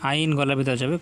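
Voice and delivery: ordinary delivery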